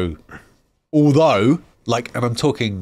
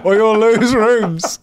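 high, slow mocking whine; mock Triple H whine; 10 semitones higher, 4 dB louder, slower than usual. Mocking a confused Triple H about his Discord. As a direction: whine it like a confused old wrestler.

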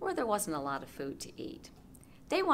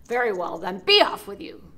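loud gruff dismissal; troll voice; 3 semitones higher, 13 dB louder than usual. Acted. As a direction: send him off like a troll.

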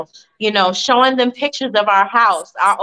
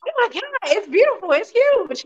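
high, gushing wonder; high thrilled daughter; amazed daughter; 12 semitones higher than usual. Retold or imitated. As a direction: gush over it in a high awed voice. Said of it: "She's like".

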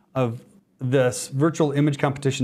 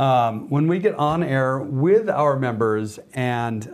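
slow, drawn-out, still excited delivery; deliberately slowing down; slower than usual. Real, not acted. Host catches herself talking too fast on air, slowing down. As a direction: rein in the rush, stretching the words. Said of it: "I'm gonna try to slow down".

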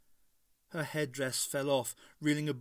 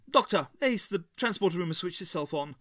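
excited call; 5 semitones higher, 6 dB louder, faster than usual. A narrator's reading. Aaron interrupts calling out.

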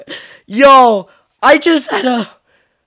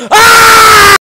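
gasp then terrified scream; 12 semitones higher, 9 dB louder than usual. Real, not acted. Door opened, monster there.

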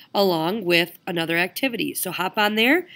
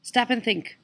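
normal talking voice

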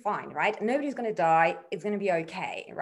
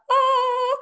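high, loud startled oh; a sudden high gasp of dismay; shocked oh; 8 dB louder than usual. Retold or imitated. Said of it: "we were all like".

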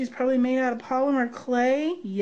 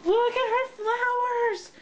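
excited squeal; 10 semitones higher than usual. Real, not acted. Crafter showing off her friend's flowers, excited squeal. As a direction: squeal it high with delight. Admiring the gift's handmade flowers.

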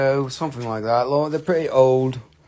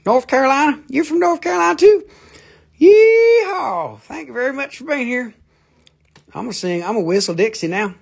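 much higher, loud yeehaw cowboy; excited southern voice; 13 semitones higher, 6 dB louder than usual. Acted. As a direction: whoop like a hyped-up cowboy.

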